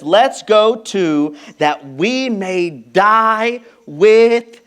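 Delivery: Thomas drawn out slow and solemn; resolute, let each word land slowly; slow resolve. Retold or imitated. Thomas telling the disciples he'll die with Jesus, slow and solemn. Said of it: "Thomas speaks up and says".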